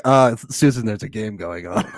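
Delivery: dismissive. Retold or imitated. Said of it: "He's so dismissive".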